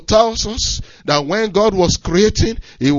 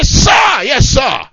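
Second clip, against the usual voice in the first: higher, louder yes sir; soldier's shout; 5 semitones higher, 7 dB louder, much slower than usual. Acted. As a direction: snap back like a drilled recruit. Soldier answering officer.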